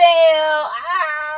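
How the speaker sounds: short loud high ah; playful shout of frustration; mock scream. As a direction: let out a quick mock yell.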